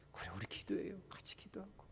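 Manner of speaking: soft comforting whisper. Retold or imitated. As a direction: whisper comfort to a hurting friend. Deaconess comforting church member. (다정하게 속삭이며)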